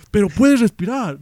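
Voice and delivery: high, loud, drawn-out mock concern; acting a worried drunk, loud and high. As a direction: call out slowly in exaggerated worry. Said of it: "yo preocupado".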